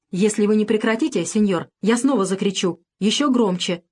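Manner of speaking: usual speaking voice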